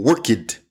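"work it" as two syllables, WORK-id; mispronounced -ed. Shown as a demonstration. Teacher showing learners' error, extra-syllable ending. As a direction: add a separate ID after "work it"'s first syllable. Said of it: "Or for example".

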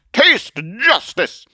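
high, loud, slow heroic accusation; indignant Zealot. Acted. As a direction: denounce a villain with grand outrage. Zealot aims pistol, threatens.

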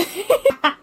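high giggle at herself; embarrassed laugh. Real, not acted. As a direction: laugh off the overshare. Embarrassed after oversharing.

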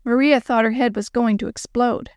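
ordinary delivery

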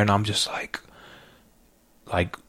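breathless unvoiced whisper; barely a breath, caught up in the moment. Real, not acted. Overwhelmed young man whispering as conviction peaks.

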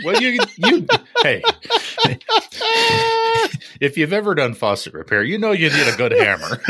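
talking through laughter; laughing. Real, not acted. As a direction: laugh while you defend yourself. Laughing while defending hammers.

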